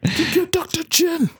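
high, strained puppet voice; like a crazed Muppet; deranged Muppet voice. Retold or imitated. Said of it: "as a deranged Muppet voice".

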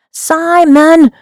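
high, very loud burst of a name; explosive, regretful shout; upset yell. A narrator's reading. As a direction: yell it with all the disappointment bursting out. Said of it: "I yelled, all my disappointment, regret, sadness, embarrassment bursting".

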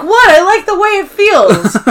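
high, loud imitated protest; mock defensive. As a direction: play an unashamed comeback. Acting Meredith's defensive reply.

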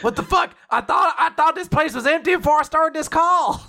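loud, high-pitched shocked yelp; startled outburst. Acted. Character caught on a private call, loud alarmed outburst. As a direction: blurt it out, pitch jumping way up.

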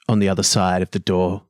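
normal talking voice

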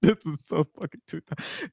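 helplessly amused, words broken by laughs; laughing. Real, not acted. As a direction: talk through real laughter. Laughing at the dated joke.

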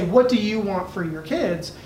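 ordinary delivery

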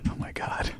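whispered, shuddering awe; hushed dread. Acted. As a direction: barely voice it, as if chilled. Recalling a frightening film, shudders.

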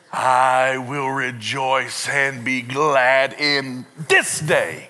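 "I will rejoice" very slowly drawn out, then "this day" punched; deliberate emphasis. Shown as a demonstration. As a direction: stretch every word up to "this day". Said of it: "different emphasis on a different syllable".

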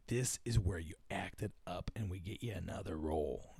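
very low, soft agent's aside; acted low, confidential voice. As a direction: coach a client quietly, voice dropped right down. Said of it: "their agents were like".

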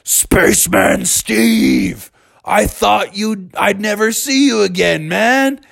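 Spaceman Mikey. Acted. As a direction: cry it out, stretching the words. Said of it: "Spaceman Mikey yelled out".

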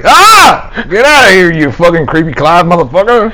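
loud, high startled outburst; startled yelling. Acted. Imagining Creepy Clive popping up over his shoulder, startled yelling. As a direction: scream and chase him off in mock panic.

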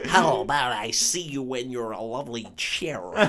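mock American accent; Sophie Turner. Podcaster mimicking Sophie Turner as Jean Grey, bad American accent. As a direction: put on a badly faked American accent. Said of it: "putting on an American accent".

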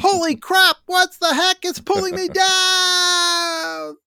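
high, loud panicked shriek; panicked yelling. Retold or imitated. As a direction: yell as you're dragged under. Voiced panic of being dragged under.

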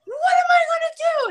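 very high reenacted shriek; frantic, high-pitched mock wail; retold panicked scream. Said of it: "I'm, like, screaming in the garage".

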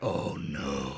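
low, hushed "uh-oh" groan of dread; mock dread. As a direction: drop way down, quietly dismayed. Acted dread at seeing the trailer.

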